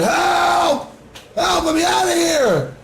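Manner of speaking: high, desperate cry from down a hole; hammed-up high plea for help; mock scream for help. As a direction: shout up like a man stuck in a pit. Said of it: "And he's screaming".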